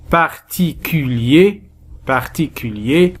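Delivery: "particulier" slowly, each syllable clear; careful word demo. Shown as a demonstration. Teacher presenting a difficult word to learners, slow and careful. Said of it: "استخراج بعض الكلمات الصعبة".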